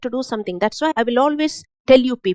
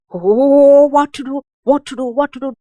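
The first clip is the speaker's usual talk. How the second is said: loud panicky cry of what to do; mock panic; 3 semitones higher, 7 dB louder than usual. Preacher mimicking a panicked believer, loud and frantic. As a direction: play the frightened one losing their head.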